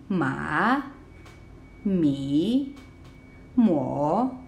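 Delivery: dipping then rising on "Ma, mi, mo"; third tone. Shown as a demonstration. Teacher asking careful listening, "Ma, mi, mo" dipping then rising. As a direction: let "Ma, mi, mo" sink deep, then climb. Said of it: "goes down, and then goes up".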